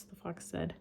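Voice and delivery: ordinary delivery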